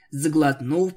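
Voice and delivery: ordinary delivery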